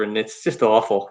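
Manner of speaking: normal talking voice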